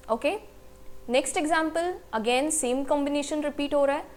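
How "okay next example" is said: ordinary delivery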